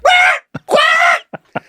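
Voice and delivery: very high, loud gull cries; squawking seagull. Acted. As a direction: screech like a seagull under attack. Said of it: "And the seagull's like".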